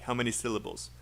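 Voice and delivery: normal talking voice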